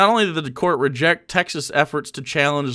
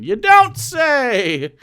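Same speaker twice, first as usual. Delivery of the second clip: slow, much higher, drawn-out mock surprise; sarcastic exclamation; 10 semitones higher, much slower than usual. Real, not acted. Streamer answering chat's secession remark, with a slow, high, sarcastic you don't say. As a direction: stretch it out as a dripping sarcastic retort.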